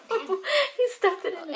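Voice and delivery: giggling through the words; laughing. Real, not acted. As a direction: laugh while saying it. Laughing at son stuffing chocolate.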